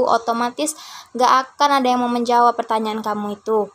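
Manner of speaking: ordinary talk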